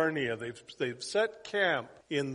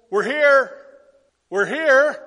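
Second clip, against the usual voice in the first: excited announcement; 6 semitones higher, 13 dB louder, slower than usual. Retold or imitated. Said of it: "he says".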